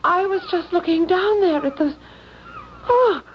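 high, trembling, near tears; badly shaken after a near fall. Shaken after nearly falling.

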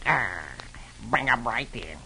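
low, soft cartoon duck voice; lightly voiced duck, held back; Donald Duck. A narrator's reading. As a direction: answer in a subdued put-on character voice. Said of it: "Donald replies".

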